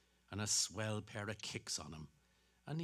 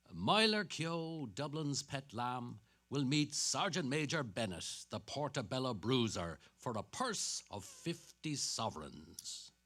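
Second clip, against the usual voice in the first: announcer read; 3 semitones higher than usual. Acted. Reads a boxing poster aloud.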